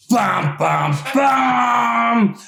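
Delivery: big, high shout with a rising bum bum bum sting; dramatic reveal. A narrator's reading. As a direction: belt out the spooky reveal like a horror-movie chord.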